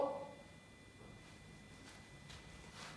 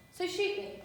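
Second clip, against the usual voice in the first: defensive rant; 3 semitones higher, 3 dB louder than usual. Acted. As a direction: throw the words back at him in a sarcastic tirade. Leah's defensive rant at Philip.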